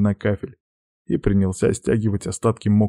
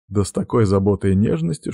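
usual speaking voice

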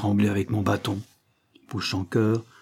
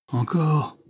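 higher-pitched demand; insistent order; 6 semitones higher than usual. A narrator's reading. Banquiste pushes child on the chairs, insistent demand. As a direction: press for more, not yet shouting. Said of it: "fit le banquiste".

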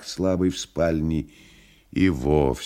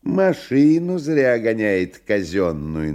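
tattling; 5 semitones higher, 5 dB louder than usual. Acted. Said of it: "наябедничал и кот".

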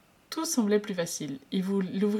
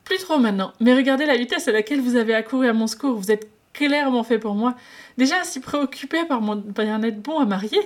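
high, loud and fast gushing; exclaiming with a grin; 6 semitones higher, 8 dB louder than usual. Acted. Wei Ying gushes at Lan Zhan, exclaiming fast and loud. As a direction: beam and rattle it off delightedly. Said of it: "s'exclama Wei Ying tout sourire".

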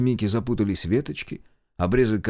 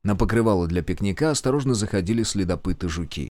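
ordinary delivery, nothing marked

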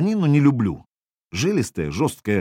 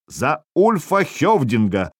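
slow, higher-pitched proclamation; провозглашает тост; 4 semitones higher, slower than usual. A narrator's reading. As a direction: draw it out as a toast to the chief. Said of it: "провозгласил он". (торжественно провозгласить)

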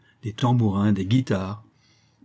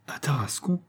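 sudden realisation; 5 semitones higher, 5 dB quieter than usual. A narrator's reading. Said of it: "s'écria Tartarin subitement illuminé".

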